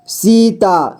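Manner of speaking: Sita drawn out as सी-ता; syllable by syllable. Shown as a demonstration. Teacher showing beginners how to read Sita, syllable by syllable. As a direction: give each half of Sita its own long beat. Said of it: "जोड़ जोड़ करके ही पढ़ना होगा".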